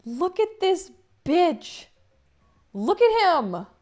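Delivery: high, loud, slow squeal. Real, not acted. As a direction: squeal over a hot drawing. Gushing over character art.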